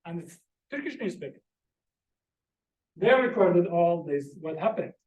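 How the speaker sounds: ordinary talk, nothing marked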